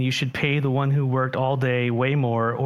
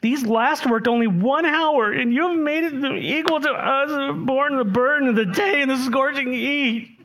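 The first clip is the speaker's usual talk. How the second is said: grumbling workers; 9 semitones higher than usual. Acted. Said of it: "they grumbled at the master of the house".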